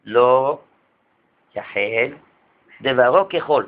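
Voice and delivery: slow, low, each word "לא", "יחל", "דברו" set apart by pauses; hinting emphasis. Shown as a demonstration. Rabbi pointing out the hint in the verse, slow and deliberate. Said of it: "רמז".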